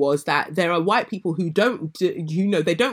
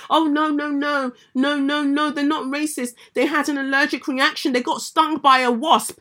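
higher mock dismissive voice; mocking excuse-maker; 8 semitones higher than usual. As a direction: lightly mimic a defensive apologist.

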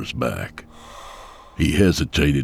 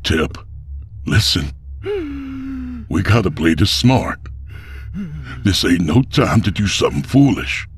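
urgent pleading; 5 semitones higher than usual. Acted. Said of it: "Abel said".

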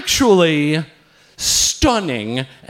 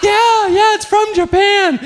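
very high, loud, excitable reply; over-eager comic imitation; eager young man; 6 dB louder than usual. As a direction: blurt it out with puppyish enthusiasm. Acting the gymnast's eager reply.

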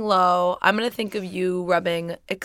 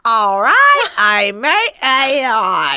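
louder, laughing Cockney; Cockney accent; 5 dB louder, much slower than usual. Pretend. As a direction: belt it out like an old Cockney bloke. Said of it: "why do you sound like a fifty-year-old Cockney man".

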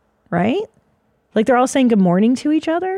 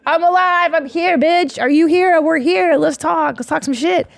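excited bird; 8 semitones higher than usual. Retold or imitated. Birds greeting the day, high shouted joy. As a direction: belt it out like a bird at dawn. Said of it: "birds are just like".

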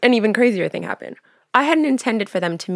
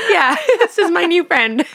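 higher, louder, giggling through it; laughing cover; 11 semitones higher, 6 dB louder than usual. Retold or imitated. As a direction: laugh off the awkwardness. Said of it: "I'm like".

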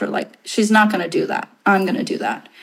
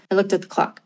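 usual speaking voice

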